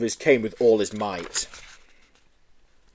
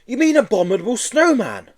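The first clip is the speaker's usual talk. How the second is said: put-on higher, helpful boy voice; boy correcting; 12 semitones higher, 5 dB louder than usual. Joe to the Yeti, higher and helpful. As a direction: help the creature out.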